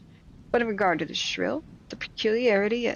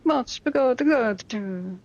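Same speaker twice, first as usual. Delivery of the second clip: exaggerated Sicilian accent, raised and loud; 5 semitones higher, 5 dB louder than usual. Reader showing how a Sicilian stresses words, mock Sicilian accent. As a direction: do a hammed-up Sicilian with bouncing stress. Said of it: "like a Sicilian would be like".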